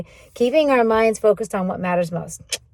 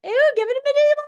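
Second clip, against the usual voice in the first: much higher and louder, thrilled at the idea; excited idea; 11 semitones higher, 4 dB louder than usual. Retold or imitated. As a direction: squeal with delight at the plan. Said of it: "And I'm just like".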